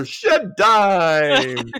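loud, high grand proclamation; grand gesture. Acted. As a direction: proclaim it with a big sweeping gesture. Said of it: "like, a grand gesture".

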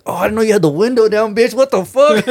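high, startled mock outrage; as if caught out by the open window; shocked. Telling his coworker he left the window down, shocked.

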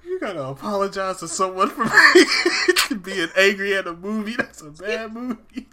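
real laughter breaking the line; can barely get it out for laughing; laughing through words. Real, not acted. Host cracking up at apologizing to mom, laughing hard.

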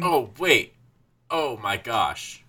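drawn-out slow oh my gosh; slow dawning realization. Real, not acted. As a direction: let it dawn on you slowly.

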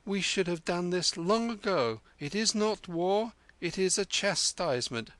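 high, stern mock officer's verdict; imitating the general. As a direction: pronounce it like a commander. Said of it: "says he".

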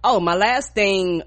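usual speaking voice

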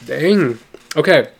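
loud exclamations, pulling together; flustered. Real, not acted. Reader regaining composure after laughing, flustered exclamations. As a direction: shake it off and reset.

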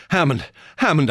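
urgent calling of a name; frightened cry. A narrator's reading. Harry calls Hammond from his bed, frightened cry. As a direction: call for your friend in fear. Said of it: "I cried".

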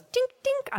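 tiny high-pitched "tink, tink"; ping it out high like tapping glass; little metallic tapping noise, mimed by voice; imitated tink sound. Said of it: "and he goes".

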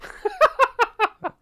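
high, loud burst of laughter. Real, not acted. Laughing at a cohost's Yorkshire tea remark, loud high laugh.

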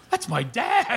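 high-pitched exclamation about his dad; retold aside. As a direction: say it high, half laughing. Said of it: "I'm just going".

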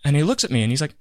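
ordinary talk

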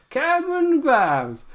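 high, drawn-out Southern holler; playful Southern catchphrase impression; hammed-up Southern accent. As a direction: call the name in an exaggerated Southern twang.

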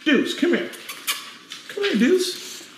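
very high pet-calling voice; calling the dog. Real, not acted. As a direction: coax the dog in a squeaky high call. Coaxing the dog to come.

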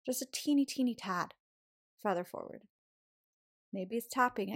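ordinary delivery

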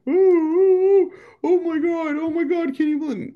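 very high, squealing caricature of a scandalized complainer; hammed-up high-pitched pearl-clutching; mock outrage. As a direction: shriek it in falsetto, mocking the outraged. Mocks outraged complainers, Karen, Chad.

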